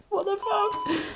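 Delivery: very high, soft squeak through laughter; breathless with giggles, baffled at yourself; real laughter squeezing the voice; laughing to tears. Real, not acted. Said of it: "I've got tears coming out of my eyes".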